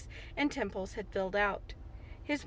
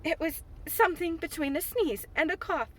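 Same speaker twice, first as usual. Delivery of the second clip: higher, prim and reproachful; reproachful dignity; 6 semitones higher than usual. Acted. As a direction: answer with wounded dignity, like a scolding girl. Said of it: "with reproachful dignity".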